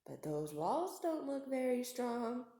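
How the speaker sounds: a hungry shark purring to himself; sly shark thinking. Acted. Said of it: "he thought".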